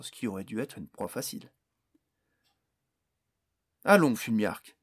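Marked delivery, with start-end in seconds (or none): 3.8-4.8 coaxing. A narrator's reading. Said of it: "dit Barnes".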